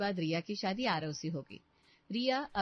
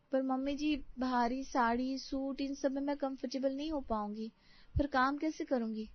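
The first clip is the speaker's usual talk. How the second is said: higher-pitched, politely protesting bride; worried young daughter-in-law; 6 semitones higher than usual. Acted. Bride pleads with mother-in-law over heavy saris, politely protesting. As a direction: object meekly as a young bride.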